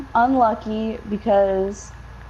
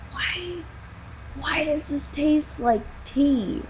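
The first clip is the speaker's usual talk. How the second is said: softer, slow puzzled question; 4 semitones higher, 6 dB quieter, slower than usual. Retold or imitated. Tasting the odd water, soft slow puzzlement. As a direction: wonder aloud, slowly and softly. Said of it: "and I was like".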